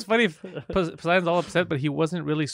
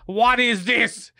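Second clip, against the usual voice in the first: very slow, loud hammed-up scream; mock outraged yell; 5 semitones higher, 4 dB louder, slower than usual. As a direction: stretch each word in mock outrage. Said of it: "I didn't say it like that".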